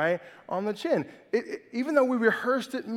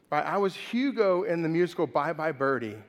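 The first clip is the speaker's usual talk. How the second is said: normal talking voice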